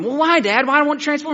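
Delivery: put-on high, loud little-boy plea; protesting kid. Boy questioning his killjoy dad, high-pitched protest. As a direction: push back like a let-down child.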